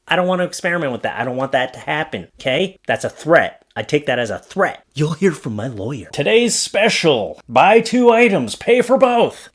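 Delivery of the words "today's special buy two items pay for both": high, slow sales pitch; sign announcer. Shown as a demonstration. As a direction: announce the bargain slowly, pitched way up. Reads a shop sign aloud.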